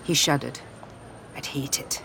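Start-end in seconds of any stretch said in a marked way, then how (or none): 1.4-2.0 breathy whisper with a shudder. Acted.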